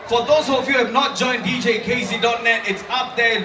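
ordinary talk

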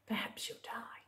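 hushed spooky whisper. Acted. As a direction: whisper the line to the child. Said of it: "whispered refrain".

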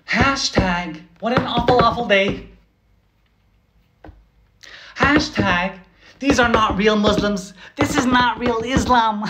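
high, hammed-up voice of a shallow poster; mock Twitter girl.

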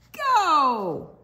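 loud, high cry of go; excited shout. Acted. Reading to kids, shouting the start of the music. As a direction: launch the dance with a shout. Story countdown to dance.

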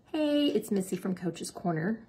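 ordinary delivery

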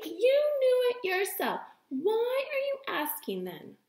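triumphant. Acted. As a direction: crow over catching her out. Pippi triumphant at teacher.

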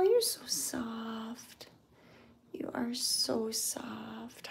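soft, slow whisper; whispered to a cat. Real, not acted. Woman whispering softly to a shy cat. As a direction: breathe the words gently to the kitten.

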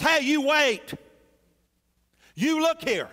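sharp high indignant protest; an angry son snapping back at his father. Acted. Elder son protesting to his father, angry and high. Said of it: "his response to the father is".

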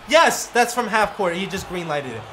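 normal talking voice

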